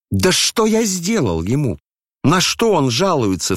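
high-pitched, protesting outcry; aggrieved exclamation. A narrator's reading. Ippolit protesting the old man's complaint, aggrieved exclamation. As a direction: cry out high, as if wrongly accused. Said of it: "вскричал Ипполит".

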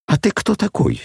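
нахальный вопрос с резким взлётом вверх; бросает вызов, задираясь; дерзко. A narrator's reading. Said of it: "с дерзким взором". (дерзко и свысока)

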